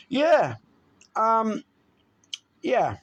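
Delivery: loud, high burst of joy; excited yeah. Real, not acted. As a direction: shout it out happily. Loud happy exclaim after photo.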